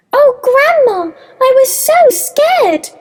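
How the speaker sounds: put-on high-pitched tearful little girl; sobbing. A narrator's reading.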